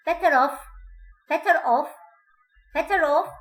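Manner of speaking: "better off" with an r linking into "off". Shown as a demonstration. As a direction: sound the r before "off". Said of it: "هذه الر ألفظها".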